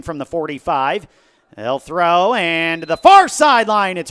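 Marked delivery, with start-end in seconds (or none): 1.6-4.1 rising, loud play-by-play burst; excited call. Real, not acted. Live play call, pass in air.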